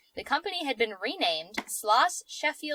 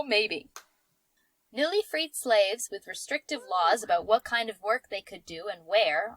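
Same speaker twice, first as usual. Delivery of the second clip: normal talking voice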